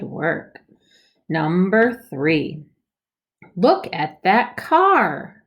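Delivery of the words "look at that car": slow, higher and louder; excited reading. Shown as a demonstration. Teacher models excited reading for kids. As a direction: read it like something amazing. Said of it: "exclamation point".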